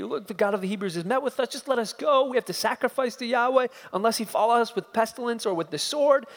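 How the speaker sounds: weak pleading defense. Acted. Preacher voicing Moses' feeble defense, hurried and pleading. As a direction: plead weakly, rushing. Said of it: "counter with a, a weak defense".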